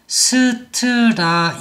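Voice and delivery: 스트라이크 as seu-teu-ra-i-keu, slow separate beats; five-syllable Korean-style. Shown as a demonstration. Teacher shows the mistaken count, five-syllable 스트라이크. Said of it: "오 음절 이렇게 생각하실 수".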